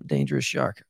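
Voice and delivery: low-pitched playful shark threat; mock menace. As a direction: drop the pitch for a joking dangerous nickname. Playful self-nickname in put-on low voice.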